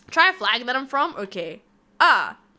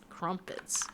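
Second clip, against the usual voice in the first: whispered crumpets; 6 semitones lower, 13 dB quieter than usual. Shown as a demonstration. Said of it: "Wait, crumpets?".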